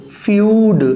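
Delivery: फ्यूड said slowly, stretching the "y" glide, "fyood"; careful teaching pronunciation. Shown as a demonstration. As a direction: blend the sounds into फ्यूड slowly for learners. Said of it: "य का sound आएगा".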